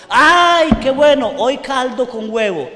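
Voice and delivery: sudden high, loud cry of delight; joyful cheer. Retold or imitated. Children cheering over egg soup, high joyful shout. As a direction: burst out like hungry kids given a treat.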